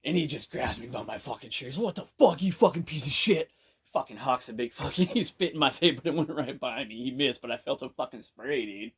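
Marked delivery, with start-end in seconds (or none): 1.8-3.5 higher, louder snarl of a stranger's insult; retold angry yell. A big guy yells at the begging speaker, angry shout. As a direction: bark it like a big guy disgusted at a beggar.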